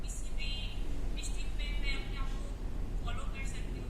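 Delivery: loud, rushed retry. Real, not acted. Candidate retrying her answer loudly as coached. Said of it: "दोबारा जवाब दो".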